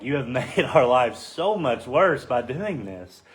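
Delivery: slow, drawn-out mock complaint; upset. Israelites blaming Moses for making their lives worse, acted upset. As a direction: stretch out an acted, exasperated accusation. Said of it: "they get upset 'cause they're like".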